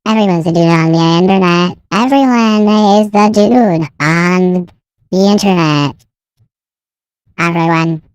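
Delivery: voice changer. Pretend. Voice changer preset.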